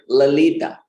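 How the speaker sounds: la-LEE-ta for Lalita; mispronounced Lalita. Shown as a demonstration. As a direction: stress wrongly on the middle syllable of Lalita. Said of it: "emphasis on the wrong syllable".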